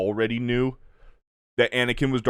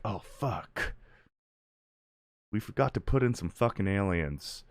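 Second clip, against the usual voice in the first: soft mock realization, sinking; low, deflated "oh crap" aside; hushed dismay; 4 semitones lower, 9 dB quieter than usual. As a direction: mutter it like editors quietly spotting their blunder. Imagined editors realizing their mistake.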